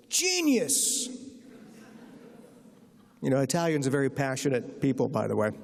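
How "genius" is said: high-pitched imitated yell, not truly loud; sudden leap into a high, mock-screamed exclamation. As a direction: imitate an overjoyed chairman yelling out one word. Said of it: "screams out the word so loudly".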